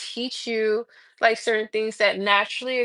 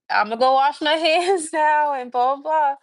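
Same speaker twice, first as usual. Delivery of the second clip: breezy, higher and louder brush-off; casual higher brush-off, a touch of laughter; playing it cool; 4 semitones higher, 4 dB louder than usual. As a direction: act totally unbothered to get out.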